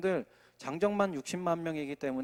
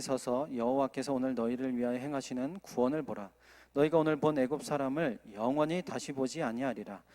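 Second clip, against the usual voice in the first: measured, solemn Bible reading; scripture reading; 3 semitones lower than usual. Acted. Preacher reading Exodus to the congregation, solemn. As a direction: read the verse with weight. Reads scripture passage aloud.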